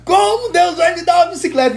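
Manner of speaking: put-on high incredulous child asking how. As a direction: squeak out a small boy's doubting "como". Son asks how God gives bike. (voz aguda de criança incrédula)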